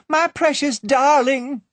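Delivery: high, slow, loud wail; wailing mother. A narrator's reading. Mother wailing for the child in the vault. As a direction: wail in terror for her child. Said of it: "wailed the mother".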